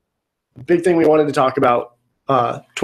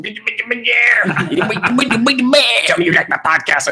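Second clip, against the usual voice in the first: Miguel voice; 9 semitones higher than usual. Retold or imitated. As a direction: croon the name over and over in a goofy high voice. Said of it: "started doing the Miguel voice".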